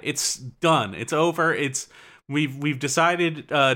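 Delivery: usual speaking voice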